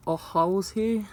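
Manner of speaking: ordinary talk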